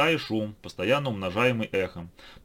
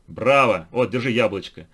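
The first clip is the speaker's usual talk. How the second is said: delighted; 7 dB louder than usual. A narrator's reading. Scribe praises madman.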